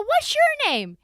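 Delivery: put-on high, loud kid-directed question; talking to a child. Host asks middle girl her name, high talking-to-kids voice.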